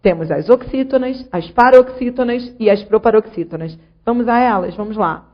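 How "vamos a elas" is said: usual speaking voice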